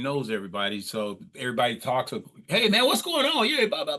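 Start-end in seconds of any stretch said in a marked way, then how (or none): 2.5-4.0 crowd chatter voice. Retold or imitated. Club regulars greeting each other.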